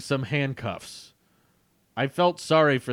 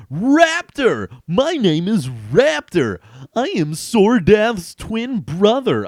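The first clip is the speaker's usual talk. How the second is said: high, loud, slow announcement; heroic declaration; 9 semitones higher, 7 dB louder, slower than usual. Acted. Hero revealing his name to the villains, grand. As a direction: proclaim your name grandly.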